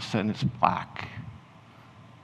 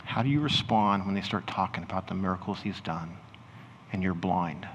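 guided imagining; 2 semitones lower than usual. Acted. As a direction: ease them gently into the scene, unhurried.

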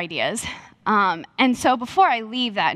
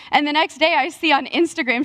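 normal talking voice

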